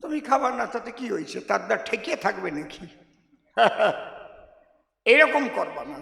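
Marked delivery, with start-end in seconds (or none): none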